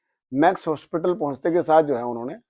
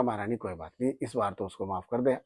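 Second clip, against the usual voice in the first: hushed pleading prayer; 5 semitones lower, 10 dB quieter than usual. Retold or imitated. Devotee pleads softly with goddess.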